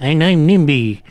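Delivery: high puppet voice; Nimby the doll. Acted. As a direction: pipe up as a little doll. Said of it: "it literally was gonna be like".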